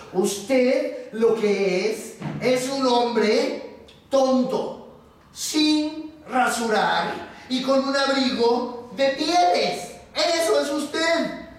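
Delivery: slow, emphatic telling-off, climbing in pitch; very angry foreman. Acted. Foreman telling the bear what he really is, exasperated. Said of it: "se puso de mal humor, enojadísimo".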